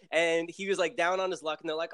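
ordinary delivery

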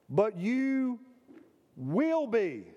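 "you will be" pressed with weight; emphatic. Shown as a demonstration. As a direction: stress on "will".

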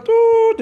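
sharp high tut of the doors shutting; sound effect. Retold or imitated. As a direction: clap out a high door-closing noise. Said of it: "Los efectos de sonido bien mamalones". (efecto de sonido agudo de las puertas)

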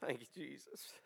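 barely holding back tears; composure breaking. Real, not acted. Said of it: "So I'm a wreck right now".

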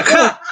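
one high clipped "ja"; single quick ja. Shown as a demonstration. Showing a laugh cut short by bad internet, one high ja. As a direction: pop out a lone high ja. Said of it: "se tienen que reír de una".

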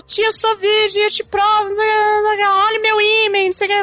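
high, hammed-up protesting girl; mock pleading niece. Sansa pleading in retold story.

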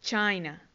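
"China" said CHAI-na, the English way; English pronunciation. Shown as a demonstration. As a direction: model "China" with an "ai" in the first syllable. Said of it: "China se dice". ("China" en inglés, suena "cháina")

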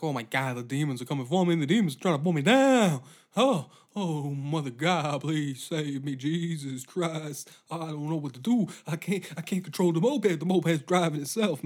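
loud, frantic pleading to God; panicked prayer. Pretend. Rider on a haunted moped, hammed-up panicked pleading. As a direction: cry out in mock terror at the demons.

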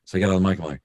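ordinary talk, nothing marked

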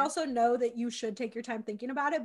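usual speaking voice

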